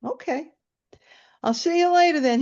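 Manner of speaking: high, little-voiced goodbye; meek agreement. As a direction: agree in a high, compliant sing-out. Stoned sister gives in sweetly.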